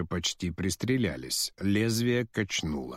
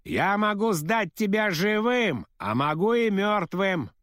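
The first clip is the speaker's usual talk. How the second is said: cocky pilot; 11 semitones higher than usual. Acted. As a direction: taunt him smugly over the radio. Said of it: "самоуверенный, задиристый голос пилота".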